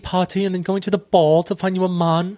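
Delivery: hammed-up Austen-film British, lifted in pitch; British period-drama accent. Film sisters planning husband hunt, mock British accent. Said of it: "when I'm doing the impressions".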